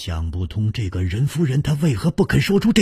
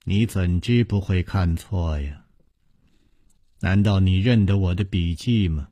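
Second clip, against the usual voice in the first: coldly; 7 semitones lower, 3 dB quieter than usual. A narrator's reading. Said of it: "任夫人冷冷地说".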